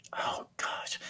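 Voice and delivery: breathy whispered oh gosh; hushed whisper of shock; whispered gasp. Retold or imitated. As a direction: whisper it in dismay. Retold moment of breaking down.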